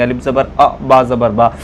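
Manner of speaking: अ and बा said quickly and short; quick short zabar. Shown as a demonstration. Teacher showing the correct quick reading, short vowel. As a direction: clip अ short and move on. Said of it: "इसको हम जल्दी से पढ़ देंगे".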